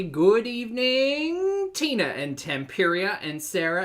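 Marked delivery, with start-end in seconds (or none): 0.0-2.1 high, slow, stretched good evening; sing-song the evening out long; drawn-out high greeting. Pretend. Host drawing out a greeting to the live chat, high and slow.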